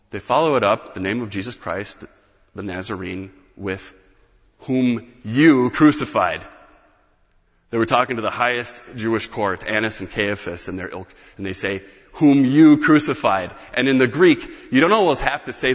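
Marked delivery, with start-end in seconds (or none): 4.6-6.4 "you" pressed slowly and louder; slow, weighted "you"; stress on you. Shown as a demonstration. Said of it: "Here they draw the word "you". 12.1-13.5 "you" punched loud; loud accusing weight on "you"; stress on you. Shown as a demonstration. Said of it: "Here they draw the word "you".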